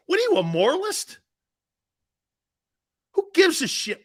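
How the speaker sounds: high, scoffing question rising at the end; incredulous challenge. Real, not acted. Host needles a judgmental listener, incredulous. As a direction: jump up in pitch, needling them.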